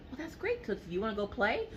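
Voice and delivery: put-on very high, soft sing-song to a child; mother talking sweetly to her toddler; sweet mommy voice. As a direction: coo at the little one. Mom sweetly answers child.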